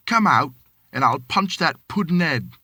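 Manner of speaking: retold challenge. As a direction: pick a fight, mock tough. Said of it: "and I says".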